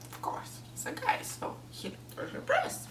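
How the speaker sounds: a cheeky whisper; lowering the voice for a naughty aside. Real, not acted. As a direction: whisper it like a secret.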